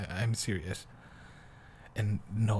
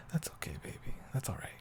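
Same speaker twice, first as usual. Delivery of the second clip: soft, higher reassuring whisper; soothing whisper; 4 semitones higher, 7 dB quieter, faster than usual. Acted. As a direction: whisper comfort to them. Soothing a tired partner.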